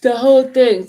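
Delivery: said through a yawn. Real, not acted. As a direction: yawn while saying it. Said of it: "Sorry, I'm yawning".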